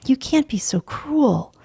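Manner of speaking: high pleading protest; pleading wife. Acted. Wife pleads with George for the children, high. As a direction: appeal to his mercy.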